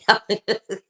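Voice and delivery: high, loud burst of laughter; amused laugh. Real, not acted. Host admits to viewers it's pretty funny, amused laugh. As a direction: break up laughing.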